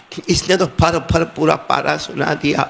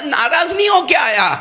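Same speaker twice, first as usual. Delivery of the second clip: higher, emphatic cry of protest; protesting shout; 8 semitones higher than usual. Retold or imitated. Boy protests to teacher. (استاد کے سامنے زور سے احتجاج)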